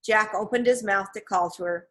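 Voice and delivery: usual speaking voice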